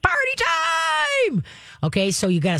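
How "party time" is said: very high, slow, thrilled shout; excited party shout. Retold or imitated. Trainer voices the dog hearing praise, thrilled high shout. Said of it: "that's like".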